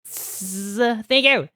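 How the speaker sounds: high, loud happy thank yous; excited thanks. Real, not acted. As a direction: cheer the donor on. Thanking subscriber, hyped.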